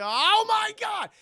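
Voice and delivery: very high, drawn-out shout; hammed-up horrified yell; mock shock. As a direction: cry out as if startled mid-sentence. Boy startled during grace.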